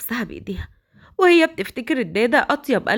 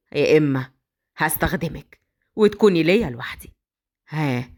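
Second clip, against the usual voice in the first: menacing kidnapper; 7 semitones lower than usual. Acted. Badr threatening the captive girl, low and smug. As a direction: savour his power over her.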